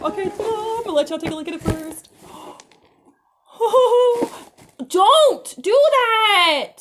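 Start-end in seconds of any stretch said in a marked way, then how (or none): 3.6-4.4 loud high startled gasp; sudden alarmed gasp; sharp gasp. Real, not acted. 4.9-6.7 high, loud, drawn-out scold; frazzled mom shout. Real, not acted. Mom yelling at child.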